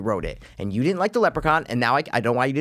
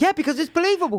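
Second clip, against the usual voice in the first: high, exasperated retort; heated comeback. Real, not acted. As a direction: fire back with the voice shooting up. Arguing back at co-host.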